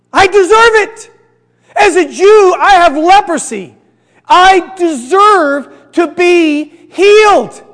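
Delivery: loud, high, insistent protest; hammed-up entitled declaration; indignant entitlement. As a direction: play someone demanding what they are owed.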